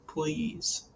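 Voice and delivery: a last faint please; words breaking up. A narrator's reading. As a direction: barely get the begging word out. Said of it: "The words began to break up in my throat".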